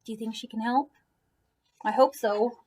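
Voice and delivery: plain reading voice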